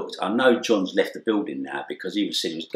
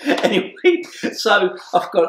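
laughing; 14 semitones higher, 6 dB louder, slower than usual. Real, not acted. Laughing while telling it.

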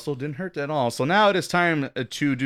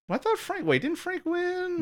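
surprised; 5 dB quieter than usual. Real, not acted. Surprised confusion.

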